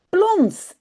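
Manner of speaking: splashy plons. A narrator's reading. Splash sound in story.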